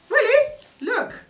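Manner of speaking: high, loud shocked question; surprised. Real, not acted. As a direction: squeal it in disbelief. Surprised by the monster.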